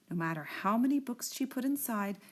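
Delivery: usual speaking voice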